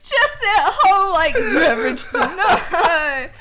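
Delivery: high, loud wail through laughter; laughing dismay. Real, not acted. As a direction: cry out in dismay while laughing. Call went straight to voicemail.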